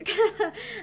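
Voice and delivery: short, shaky little laugh; brief nervous laugh. A narrator's reading. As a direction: laugh weakly and unsteadily. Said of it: "Violet laughed shakily".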